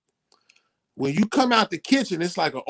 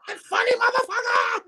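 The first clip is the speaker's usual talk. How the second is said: furious mama. Retold or imitated. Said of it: "She was like".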